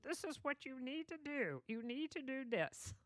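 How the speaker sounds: a hint of a scolding mother laying down the law. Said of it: "I'm scolding you".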